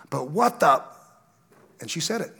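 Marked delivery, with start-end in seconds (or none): 0.1-0.8 mock exasperated cry of the sister; exasperated outburst. Sister venting at the pastor, exasperated. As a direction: blurt it out in disbelief. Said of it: "She goes".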